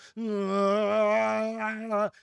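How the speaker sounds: high, loud barking seal grunt; seal grunt imitation. Streamer demonstrating his seal-mode screaming to chat, loud seal bark. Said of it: "I start doing the whole".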